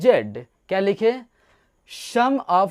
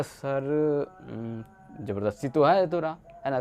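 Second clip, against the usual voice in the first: much softer, lower, hesitant confession; sheepish admission; 5 semitones lower, 6 dB quieter than usual. Retold or imitated. Letter ch sheepishly admitting to the king, soft and hesitant. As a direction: admit it shyly, voice dropping away. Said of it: "तो बोलता".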